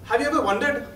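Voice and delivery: ordinary delivery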